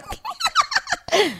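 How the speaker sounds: very high cartoon puppet cackle; puppet's teasing squeaky laugh; mocking laugh. A narrator's reading. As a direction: cackle up high to tease him. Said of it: "cominciò subito a ridere e a canzonarlo".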